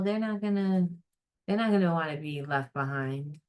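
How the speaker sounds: normal talking voice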